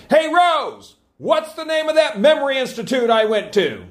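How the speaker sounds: high, loud holler across a room. Retold or imitated. Husband hollers to his wife across the room, loud and high. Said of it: "hollered across the room".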